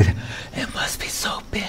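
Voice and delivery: breathy whisper, almost no voice. Acted. To the audience about the infinite, whispered in mock awe. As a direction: hush it like a secret.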